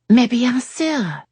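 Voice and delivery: put-on high, slow French accent; French lady. A narrator's reading. Yasmin replying to Jake in slow, high French. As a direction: toss off the French like a native.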